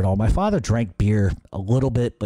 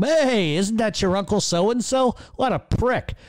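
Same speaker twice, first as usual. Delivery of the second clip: high-pitched imitation of dad barging in; exaggerated high-pitched father impression; 11 semitones higher, slower than usual. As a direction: call it out high, mocking dad. Acting out father bursting into the room.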